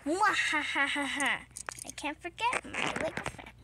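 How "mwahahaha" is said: gloating villain cackle; mad scientist. Acted. Gloating after stealing everything.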